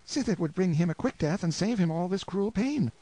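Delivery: put-on high, trembling plea of a desperate young wife; pleading wife. Anguished wife begging the lord to persuade Hugo, high pleading voice. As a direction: beg him to save your husband.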